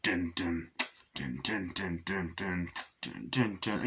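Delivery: low, soft hummed dun dun of the score; hummed film score. Retold or imitated. Reviewer imitating the film's music to viewers, low soft humming. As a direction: hum the menacing theme quietly, low. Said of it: "you have the music".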